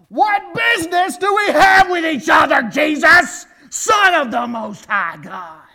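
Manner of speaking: high, full-force desperate scream; yelling. Acted. Possessed man shouting at Jesus, screamed at full force. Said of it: "He yells this. He doesn't just speak it".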